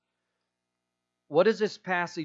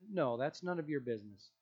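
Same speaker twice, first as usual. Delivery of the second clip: ordinary delivery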